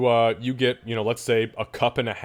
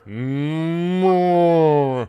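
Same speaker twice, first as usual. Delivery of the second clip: long, rising moo, loud; big swelling cow moo; cow's moo; 5 semitones higher, 6 dB louder than usual. Acted. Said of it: "you hear".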